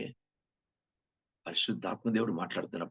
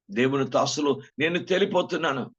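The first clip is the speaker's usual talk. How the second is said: higher, louder cry of realization; rising alarm; 6 semitones higher, 6 dB louder than usual. Retold or imitated. Preacher voicing a sinner who finds himself too light, alarmed cry. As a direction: let the alarm break out. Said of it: "అర్థమవుతుంది".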